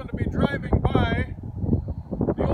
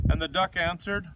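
ordinary delivery, nothing marked

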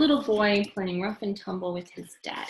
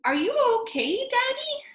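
put-on high, fast child's voice; worried child; 3 dB louder than usual. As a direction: ask like a worried little boy.

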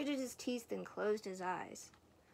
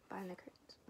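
whispering; 3 semitones lower, 12 dB quieter, faster than usual. Acted. Narrator drops to whisper.

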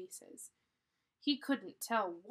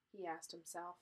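plain reading voice

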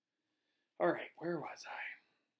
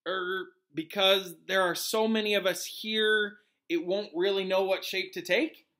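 slow, higher tentative student; hesitant pupil answering; 5 semitones higher, 5 dB louder than usual. Acted. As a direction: venture an answer as a question.

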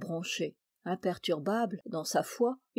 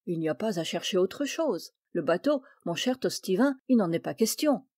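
ordinary delivery

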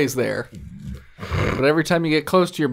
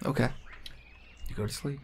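whispered softly, unvoiced; soft bedtime whisper; 3 semitones lower, 15 dB quieter, faster than usual. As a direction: hush them to sleep. GM answers player.

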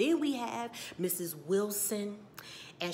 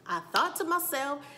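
raised restatement; 6 semitones higher, 5 dB louder than usual. Shown as a demonstration. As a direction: restate it in a lifted, loud voice. Same words repeated less drawn out.

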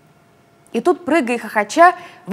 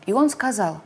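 ordinary delivery, nothing marked

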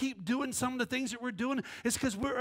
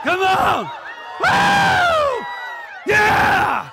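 joyful whoops, much higher and louder; excited shout; 9 semitones higher, 13 dB louder, much slower than usual. Real, not acted. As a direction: rouse the room. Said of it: "like you actually believe".

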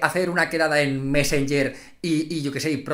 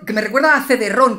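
normal talking voice

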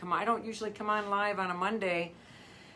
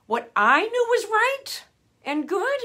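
much higher and louder, slow, bursting out with feeling; passionate outcry; 13 semitones higher, 10 dB louder than usual. Real, not acted. Vocal coach to viewers, bursting out loud and high. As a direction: cry it out from years of frustration. Passionate outburst about past self-doubt.

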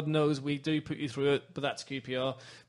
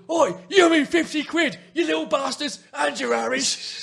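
loud, high cockney yell; angry East End man; 11 semitones higher, 8 dB louder than usual. Retold or imitated. East End dog seller yelling at the kids, angry shout. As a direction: bellow after them like a furious street trader. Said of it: "the man was going".

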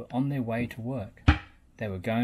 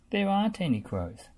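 pointing out; 9 semitones higher, 3 dB louder than usual. A narrator's reading. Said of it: "Travis pointed out".

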